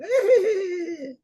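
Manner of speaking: high-pitched giggle, imitating a woman; imitated lady's laughing. Lady laughing in the background at the street exchange, imitated high laugh. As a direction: mimic her high, drawn-out cackle. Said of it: "this lady laughing".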